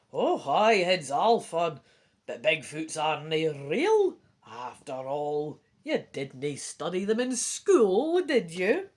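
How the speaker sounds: loud, slow dad voice. A narrator's reading. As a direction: play a dad, loud and drawn out. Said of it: "Your dad says".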